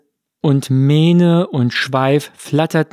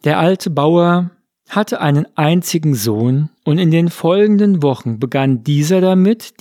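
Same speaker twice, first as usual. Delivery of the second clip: usual speaking voice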